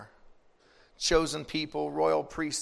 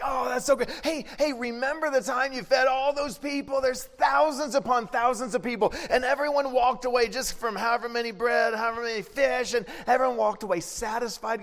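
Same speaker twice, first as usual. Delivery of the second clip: high, laughing, near-shouting enthusiasm; excited Peter; 8 semitones higher than usual. Retold or imitated. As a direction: burst out with delight. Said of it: "Peter's like".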